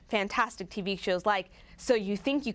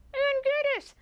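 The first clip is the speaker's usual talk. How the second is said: blurted out much higher; sudden recall. Real, not acted. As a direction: shoot the name out as it suddenly comes back.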